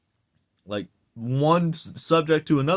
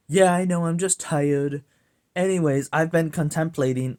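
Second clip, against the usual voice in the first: higher-pitched take on a coworker; lightly voiced coworker; 4 semitones higher than usual. Coworker brushing off a correction, lightly imitated. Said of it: "and they said".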